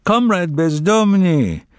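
slow, high and pompous address; ceremonial speech voice. A narrator's reading. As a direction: speak like at an anniversary banquet. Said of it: "in a voice suited to anniversary celebrations".

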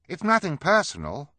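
high, loud put-on voice, hastily added; hasty reassurance. A narrator's reading. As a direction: jump in to smooth it over in a high voice. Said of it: "Klaus added quickly".

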